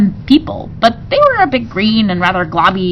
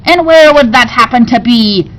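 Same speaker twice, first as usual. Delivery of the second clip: pirate queen voice; 4 semitones higher, 6 dB louder than usual. A narrator's reading.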